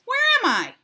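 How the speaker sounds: high startled question; just woke up bewildered; confused. A narrator's reading. Waking confused.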